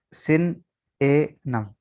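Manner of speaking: सिन ए नम broken into very slow separate syllables; slow teacher breakdown of सिन ए नम. Shown as a demonstration. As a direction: spell out सिन ए नम slowly for the class. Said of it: "असल pronunciation इसकी जो है".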